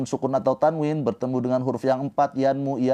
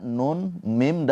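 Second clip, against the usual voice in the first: ordinary talk, nothing marked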